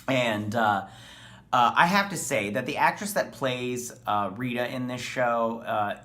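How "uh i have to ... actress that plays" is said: usual speaking voice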